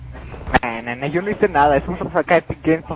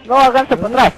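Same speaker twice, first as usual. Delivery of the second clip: urgent shout; 8 semitones higher, 7 dB louder than usual. Real, not acted. Warning teammates to retreat.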